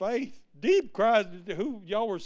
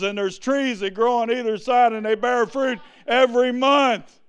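raised, higher preaching voice; 8 semitones higher, 8 dB louder than usual. Real, not acted. Preacher telling of the river in Revelation, forceful preaching. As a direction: proclaim it with force.

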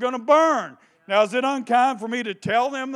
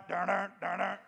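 hummed riff; 10 dB quieter than usual. Pretend. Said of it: "I wish I could play that".

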